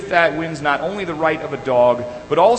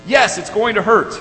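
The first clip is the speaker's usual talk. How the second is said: loud, high snapped answer; retold dad losing his cool; acted parental impatience, raised voice; exasperated father; 8 semitones higher, 5 dB louder than usual. Exasperated father answers crying son.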